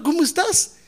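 high, friendly how-are-you; sing-song cheerful question; cheerful greeting. Retold or imitated. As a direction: ask brightly, full of goodwill. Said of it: "Yo preguntándole".

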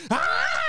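high-pitched fake bawl; mock crying wail. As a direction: burst into an exaggerated parent's wail. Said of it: "start crying".

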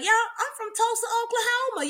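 high, lightly drawled Southern accent imitating a friend; high mock Southern accent introducing herself. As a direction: put on a cute Southern accent. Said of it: "you were like".

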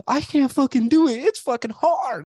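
high, loud mocking whine, slow at the end; imitating a weak recruit who wants to quit, whining; mock whiny quitter. Mocking recruits who dropped their rifles, in a high whine.

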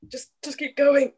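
waving the band on while hurting; mock teary plea. Telling Tyler to carry on, mock strained. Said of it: "I was like".

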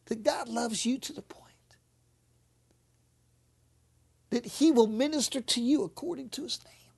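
breathy whispered appeal. Real, not acted. Preacher whispering to the congregation about God's love.

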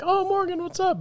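excited fan greeting. Retold or imitated. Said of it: "Oh, somebody showed up".

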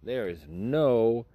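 dragging incredulous emphasis; emphatic disbelief. Real, not acted. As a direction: spell out the outrage.

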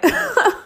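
high, loud laugh breaking through tears; half laugh, half sob; teary laugh. Real, not acted. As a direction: laugh at yourself while starting to cry. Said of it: "I think I'm gonna cry".

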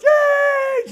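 high, loud imitated group cheer; a long, shouted mock whoop of delight; excited cheer. Imitating the whole car cheering at the sign, shrieked high.

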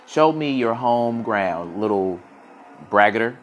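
slow, mildly irritated challenge. A narrator's reading. Falcon challenging the boastful quill, irritated. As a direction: draw out the dare, firm on the last word. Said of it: "irritated despite himself".

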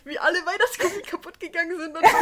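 high-pitched amused telling with laughter; laughing. Real, not acted. Laughing while telling friends about mother throwing tree. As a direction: laugh through the picture of smashed ornaments. Picturing ornaments smashing, laughing.

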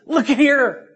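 high-pitched call for attention. Acted. Christ at the door calling the church, calling out. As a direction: call them over, pitched well up.

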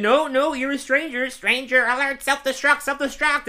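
high, loud imitation of a panicked alarm; hammed-up high-pitched freak-out; mock panic. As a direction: sound a pretend alert, high and urgent. Acted panicky outburst in retold scene.

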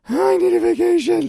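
high, loud strained cry; stressed-out outburst. Retold or imitated. Comic telling how stressed he was, overwrought yell. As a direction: blurt it out at full pitch, overwrought.

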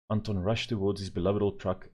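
plain reading voice

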